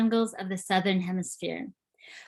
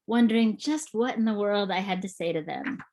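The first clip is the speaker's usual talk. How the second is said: ordinary talk, nothing marked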